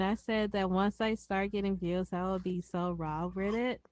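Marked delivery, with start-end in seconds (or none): 2.9-3.3 "raw" said with a w sound, like waw; "raw" starts with a soft w instead of an r; says w for r. Real, not acted. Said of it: "You laughing 'cause I said raw".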